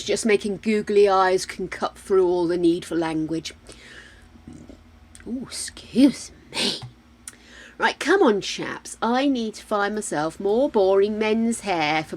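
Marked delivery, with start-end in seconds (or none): 5.3-6.8 whispered aside. Real, not acted. Aside to game character.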